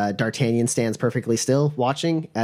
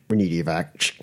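low radio call; 6 semitones lower than usual. Acted. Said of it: "is like".

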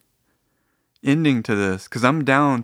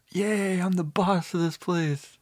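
small, high, drawn-out cheer; mock triumph; 7 semitones higher, 5 dB quieter, slower than usual. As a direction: celebrate softly in a put-on high voice. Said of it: "I'm like".

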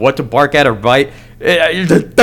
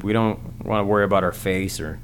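normal talking voice

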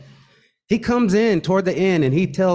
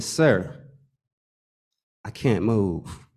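slow, soft, lower, terrified; frozen with fear; 7 semitones lower, 4 dB quieter, much slower than usual. Retold or imitated. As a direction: barely get the words out. Frozen at gunpoint.

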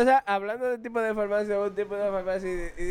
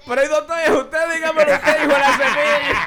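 exasperated shout; 6 semitones higher, 7 dB louder than usual. Retold or imitated. Patient's exasperated joke punchline.

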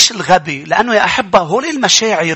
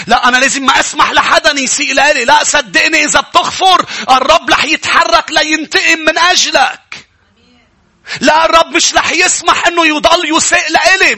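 high, loud, driving preacher voice; full-force preaching; 10 semitones higher, 4 dB louder than usual. Acted. (وعظ حماسي بصوت عالٍ)

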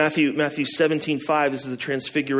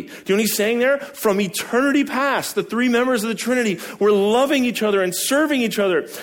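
rising preaching fervor; 9 semitones higher than usual. Real, not acted. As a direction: preach it with growing joy. Preacher building to climax.

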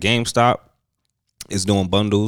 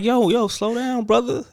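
much higher, slowed-down plea; mock pleading; 13 semitones higher than usual. Telling friend to slow down.

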